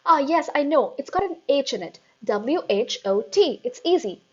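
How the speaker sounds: eager guess. A narrator's reading. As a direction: rush it out hopefully.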